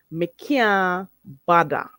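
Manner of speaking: "Me can't bother" stretched slowly, like "mi kyah badda"; Jamaican Creole. Shown as a demonstration. Teacher repeating the Jamaican way for learners, slow Jamaican Creole. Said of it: "the Jamaican way, you say".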